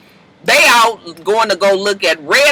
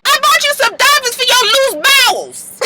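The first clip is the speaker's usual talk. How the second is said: very high Pooh impression; high and sly. Pooh needling Carly about the sheets, very high voice. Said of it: "she was just like".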